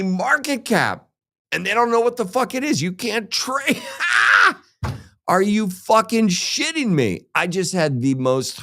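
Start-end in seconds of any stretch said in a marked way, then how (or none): 3.9-4.5 a sudden high, loud burst of laughter; laughing outburst at Coinbase; shouted laugh. Real, not acted. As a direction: bark out a disbelieving laugh.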